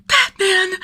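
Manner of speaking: Batman! yelped high and loud in awe; a fan seeing his hero; awed exclamation. A narrator's reading. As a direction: blurt the name out in starstruck surprise. Tim sees Batman, thrilled.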